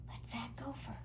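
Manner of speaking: soft unvoiced whisper. Teacher whispering to children while reading the story. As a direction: hush it like a secret to the children. Quietly adding a puppet to the scene.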